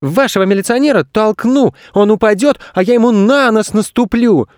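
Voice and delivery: put-on high-pitched angry child voice; angry boy. A narrator's reading. Boy answering back to an old woman, angry. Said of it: "от злости сам не понимая".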